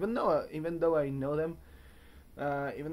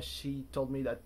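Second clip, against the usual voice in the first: ordinary talk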